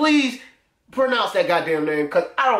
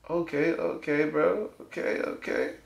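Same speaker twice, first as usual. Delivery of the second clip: whispered okay; 4 semitones lower, 8 dB quieter than usual. Real, not acted. Muttering while swiping.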